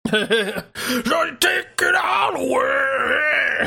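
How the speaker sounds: slow, strained grunge-rock growl; wrestler voice. Retold or imitated. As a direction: drag it out like a wrestling promo. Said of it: "the wrestler voice is kinda how it is".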